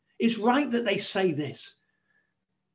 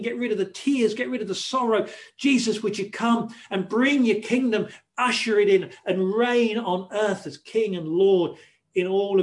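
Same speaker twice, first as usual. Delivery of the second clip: rising, louder pleading prayer, building on each "get rid of"; fervent prayer; 5 dB louder than usual. Acted. Preacher praying aloud to Jesus in the sermon, fervent. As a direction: cry out to Jesus to come and set the world right.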